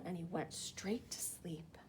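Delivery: hushed narration; whisper. A narrator's reading. Narrator whispering as the dragon sleeps.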